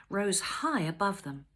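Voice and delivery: usual speaking voice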